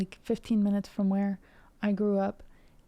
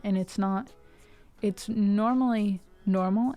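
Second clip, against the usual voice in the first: normal talking voice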